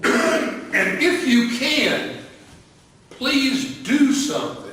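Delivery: high, loud and slow, begging for help; desperate plea. Retold or imitated. Father begging Jesus for his son, high and slow desperate plea. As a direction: plead like a father at the end of his rope.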